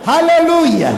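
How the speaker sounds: loud, high Hallelujah shout; rousing call after the laughter; preacher's call. Real, not acted. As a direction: lift the church with it.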